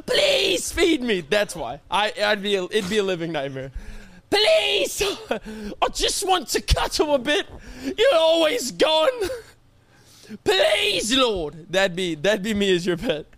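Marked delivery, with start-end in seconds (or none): 0.1-1.2 whiny pet voice. Pretend. Pet begging owner for food, high whiny plea. 4.3-11.7 high crying plea for cuddles; whiny pet voice. Pretend. Pet begging absent owner for cuddles, high whiny plea.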